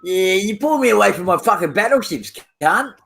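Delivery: normal talking voice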